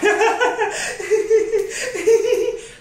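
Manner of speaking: long, high run of giggles; giggling. Real, not acted. Woman cracking herself up about her partner, giggling. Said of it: "I'm just giggling".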